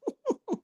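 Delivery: high, soft amused chuckle; mock chuckle. God chuckling at a doubter, soft laugh. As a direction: chuckle lightly as God would. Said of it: "He chuckling like".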